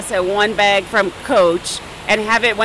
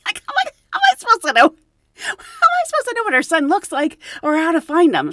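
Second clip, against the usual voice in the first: higher, louder, fast exasperation; exasperated; 9 semitones higher, 5 dB louder, faster than usual. Real, not acted. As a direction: vent like it's absurd. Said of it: "exasperated retelling".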